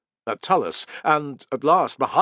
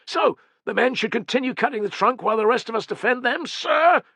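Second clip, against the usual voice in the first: "sir?" pushed up high and loud; heavy emphasis on sir; 12 semitones higher, 4 dB louder than usual. A narrator's reading. Optio challenging his centurion, hitting "sir?" hard. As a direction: lean hard on "sir?" as a pointed jab. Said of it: "demanded Fenestella, laying heavy emphasis on the last word".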